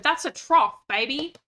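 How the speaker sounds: loud, proud announcement; excited. Real, not acted. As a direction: crow it out triumphantly. Triumphant exclamation.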